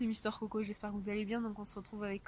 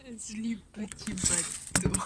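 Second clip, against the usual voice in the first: slow whisper; 6 dB louder, much slower than usual. Real, not acted. Repeats punchline in whisper.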